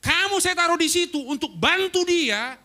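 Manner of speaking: angry senior pastor scolding his protégé; angry rebuke. Retold or imitated. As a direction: lay down the law in anger. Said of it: "Pak Roni marah besar".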